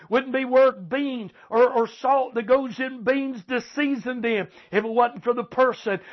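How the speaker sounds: ordinary talk, nothing marked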